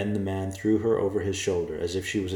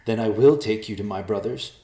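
louder, spiteful threat; venomous; 4 semitones higher, 8 dB louder than usual. Acted. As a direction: spit the words with venom. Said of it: "said with venom".

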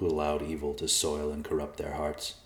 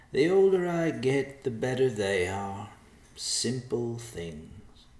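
put-on slow, higher old man's voice, easing off at the end; contented old man; 6 semitones higher, slower than usual. A narrator's reading. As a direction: muse slowly, savouring the thought. Old man savouring his stew.

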